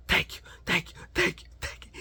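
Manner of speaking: whispered relieved thanks; grateful whisper after escaping; breathless thanks. Real, not acted. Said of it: "relief after escaping".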